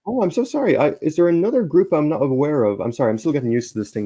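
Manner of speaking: ordinary talk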